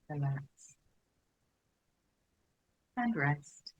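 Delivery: unhurried, even, spacious delivery; guided meditation. Real, not acted. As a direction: guide them gently into settling, phrase by phrase. Leads a settling meditation.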